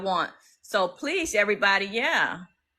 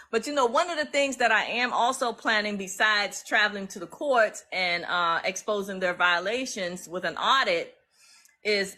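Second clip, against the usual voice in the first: ordinary talk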